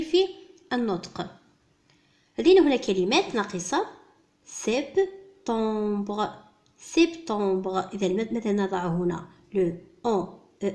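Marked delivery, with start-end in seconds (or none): 4.4-6.3 "سبتمبر" slowly as French sep, tembre; syllable by syllable. Shown as a demonstration. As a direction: break "سبتمبر" in two with a clear gap. Teacher sounds out incomplete word.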